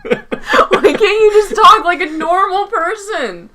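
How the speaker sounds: high, drawn-out laughing protest; exasperated whine. Real, not acted. Co-host begging podcaster to talk normally, exasperated. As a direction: wail the question in exasperation.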